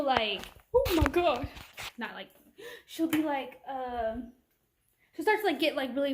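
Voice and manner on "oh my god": high, loud "oh my God"; mock excited gasp. Imitating the friend exclaiming over the dog. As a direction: burst out in delight.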